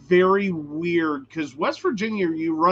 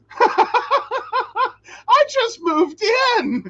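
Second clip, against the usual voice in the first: high, slow laughing wail; laughing mock wail; 5 dB louder, much slower than usual. Retold plea, laughing and crying.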